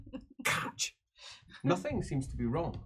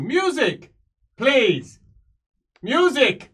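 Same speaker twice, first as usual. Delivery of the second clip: loud, higher call for music; 11 semitones higher, 10 dB louder, much slower than usual. Acted. Emperor ordering the musicians to play, loud call. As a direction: summon the musicians across the hall.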